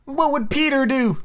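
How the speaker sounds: put-on high-pitched cry of the boys; acted boyish outcry. A narrator's reading. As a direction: call it out high, like excited children. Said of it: "they cried simultaneously".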